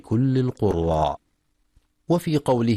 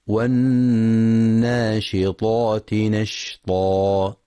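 slow, drawn-out tajweed chanting; measured melodic Quran recitation; slower than usual. Shown as a demonstration. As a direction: recite with full ghunna and long vowels.

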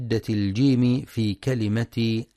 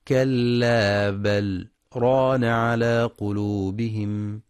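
كلا بل then a short silent break, no breath, before ران; brief pause after بل; slower than usual. Shown as a demonstration. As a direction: stop briefly on the ل of بل without breathing. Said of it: "بسكتة لطيفة على اللام". (سكتة لطيفة على لام بل)

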